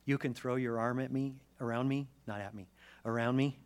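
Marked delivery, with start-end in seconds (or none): none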